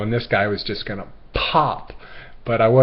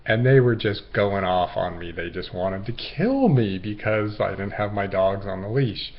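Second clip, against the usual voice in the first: ordinary talk, nothing marked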